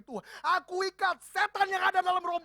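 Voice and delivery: loud, high commanding rebuke; mock spiritual-warfare shout; fierce deliverance prayer. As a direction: bind the devil in a showy voice. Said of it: "lebih serem lagi".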